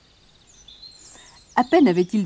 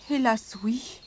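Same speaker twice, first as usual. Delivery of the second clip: regretful; 7 dB quieter, slower than usual. Acted. Prince regretfully admits it.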